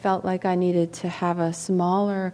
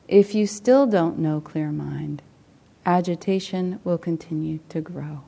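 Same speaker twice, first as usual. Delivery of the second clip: measured teaching read aloud. Acted. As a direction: read it as a contemplation, phrase by phrase. Reads a meditation teaching aloud.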